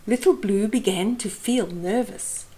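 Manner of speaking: usual speaking voice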